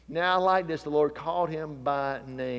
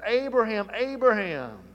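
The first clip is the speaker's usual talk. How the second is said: calling out; 6 semitones higher, 4 dB louder than usual. Acted. God calls Abraham.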